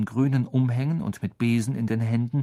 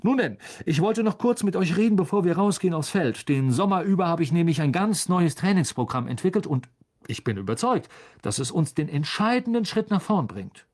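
lightly voiced eager team captain, a touch higher; Wood, keen captain; 6 semitones higher than usual. A narrator's reading. Captain Wood to his sleepy team, lightly voiced and eager. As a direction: pitch it up a little as the fired-up captain.

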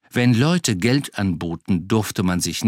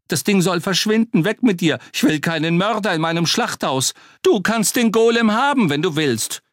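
high, loud frantic yelling; frantic shouting; 9 semitones higher, 4 dB louder than usual. Acted. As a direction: wave it off, shouting wildly. Butcher shouts golem away.

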